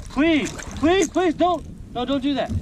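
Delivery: high, panicky begging; urgent pleading. Real, not acted. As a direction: plead desperately as it slips away. Begging the hooked fish not to escape.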